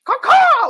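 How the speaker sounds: loud, high bird caw; imitated bird cry. Speaker mimics the bird that carried mail, loud high cawing. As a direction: screech like a messenger bird. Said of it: "would just be like".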